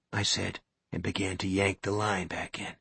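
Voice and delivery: ordinary delivery, nothing marked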